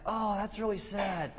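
slow, high, drawn-out pity; syrupy feigned concern; mock sympathy. Mimics own half-hearted sympathy.

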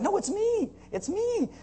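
high, soft insisting voice; insistent healed man. Retold or imitated. Healed man insisting to neighbors, high and pleading. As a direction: plead that it's really you. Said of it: "the guy's like".

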